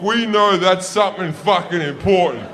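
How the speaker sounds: ordinary talk, nothing marked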